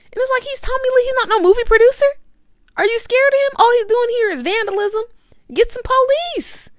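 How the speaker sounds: high, loud, incredulous ranting; exasperated rant. Real, not acted. Calling out the crew on set, loud and high-pitched. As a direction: demand answers loudly and high.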